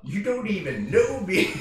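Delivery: high, loud, drawn-out protest; hammed-up, slow, high objection; mock protest. Acting out reply to love declaration.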